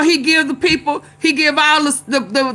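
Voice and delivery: ordinary talk, nothing marked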